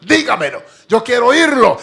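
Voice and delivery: insist, voice rising in desperation; pleading shout; pleading cry. Retold or imitated. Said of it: "le decía al marido".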